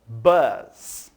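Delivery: bus said the English way, for students to repeat. Shown as a demonstration. Teacher models bus for the class, in English pronunciation. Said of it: "Mais uma vez".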